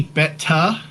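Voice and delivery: Betta as bet-TAH, crisp T; alternate pronunciation. Shown as a demonstration. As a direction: say Betta with a clearly sounded T. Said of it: "It might be Betta".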